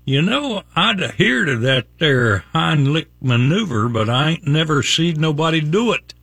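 put-on hillbilly accent. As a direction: deliver it as a backwoods hillbilly brother. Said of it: "I've gotta use a hillbilly accent".

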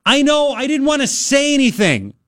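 high-pitched mock call from wife; imitated wife calling from another room; retold yell back. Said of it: "She yells".

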